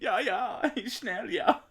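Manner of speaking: high, loud put-on German voice; hammed-up German, pitched right up; mock German. Imitating a man speaking German, in a high mock German voice. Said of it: "say something in German, and I went".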